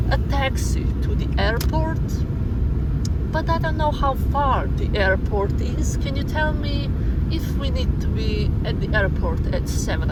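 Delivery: a hint of a polite anxious tourist; mock guest listing requests; imagined guest. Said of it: "oh, he will be like".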